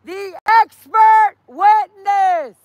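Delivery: high, loud, slow street callout; drawn-out, projected protest yell; protest shout. Real, not acted. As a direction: bellow each word to the street. Shouts book plug to the street.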